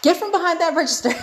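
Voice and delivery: high, fast challenge breaking into laughter; hammed-up call-out, half laughing; mock fighting challenge. Customer squaring up to cashier in her head, mock fighting challenge.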